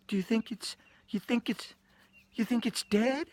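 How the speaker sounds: high, small, halting mock-female voice; timid shocked question. Storyteller voicing Donna over the dead cat, high timid halting voice. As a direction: stammer it out high and hesitant.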